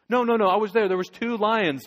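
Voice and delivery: loud, high insistence; insistent protest. Acted. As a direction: shout him down: I was there. Shasta insists on two lions.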